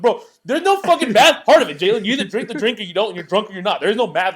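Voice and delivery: loud, high exasperated rant; exasperated argument. Retold or imitated. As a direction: replay shouting down a friend's silly logic. Retold argument with Jalen.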